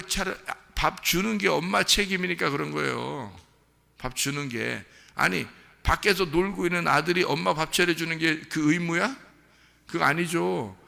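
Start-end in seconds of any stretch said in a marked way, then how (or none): none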